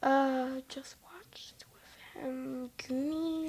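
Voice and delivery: whispering to herself while thinking; soft unvoiced muttering of the question. Real, not acted. Kid whispers the question back while trying to remember.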